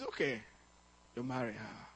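a reluctant father giving his blessing; father's consent. Retold or imitated. Father finally gives consent.

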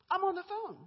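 high, loud mock protest; exasperated protest. Recruiter on a call as the boss yells, exasperated protest. Retold protest over boss's shouting.

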